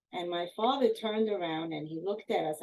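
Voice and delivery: normal talking voice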